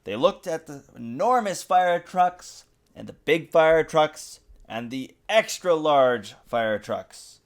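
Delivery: ordinary delivery, nothing marked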